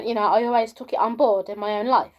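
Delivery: normal talking voice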